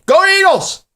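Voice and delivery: high excited cheer. Real, not acted. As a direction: shout it for the team. Fan cheers team.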